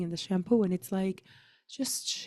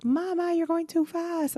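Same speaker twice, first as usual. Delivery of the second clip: put-on high, childish plea; little daughter's voice; 11 semitones higher than usual. Daughter complains to running mama, high childish whine. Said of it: "She's like".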